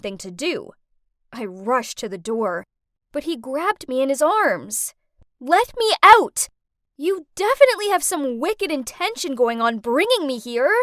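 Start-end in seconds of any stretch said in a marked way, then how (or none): 5.4-10.7 loud angry demand; scowling, furious protest. Acted. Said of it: "I scowled".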